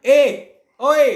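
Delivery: loud call ए then ओए, pushed from the throat. Shown as a demonstration. Teacher shows how you call someone, ए ओए from the throat. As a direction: hail someone across the street. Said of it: "किसी को बुलाओगे ना".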